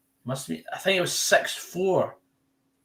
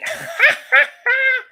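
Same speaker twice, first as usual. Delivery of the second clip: long high laugh; high laughter; 9 dB louder than usual. Real, not acted. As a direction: cackles at the neighbours. Laughs at English neighbours.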